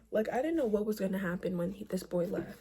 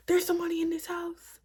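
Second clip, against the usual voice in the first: high, loud alarmed dad yell; 8 semitones higher, 6 dB louder than usual. Retold or imitated. Dad bursting from the shower, high loud alarmed yell. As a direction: shout like a dad hearing an intruder.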